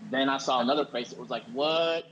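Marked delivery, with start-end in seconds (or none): 1.5-2.0 mock shocked what, pitched up and louder; a retold jolt of shock. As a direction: act out a stunned what. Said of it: "It was like shock".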